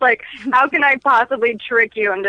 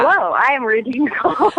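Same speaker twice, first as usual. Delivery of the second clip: mock operator voice; 3 semitones higher than usual. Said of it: "You're just like".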